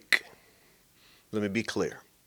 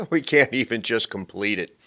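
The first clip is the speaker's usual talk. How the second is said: ordinary talk, nothing marked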